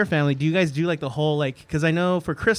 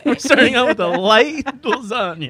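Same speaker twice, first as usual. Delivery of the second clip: laughing; 5 semitones higher than usual. Retold or imitated. Mock casual host.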